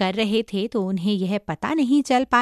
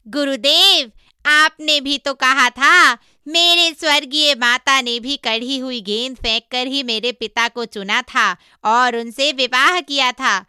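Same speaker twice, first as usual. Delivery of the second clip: higher, much louder character voice; monkey disciple, eager self-defence; 3 semitones higher, 6 dB louder than usual. Acted. Monkey explaining himself to his master, eager self-defence. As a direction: pipe up loudly and insistently.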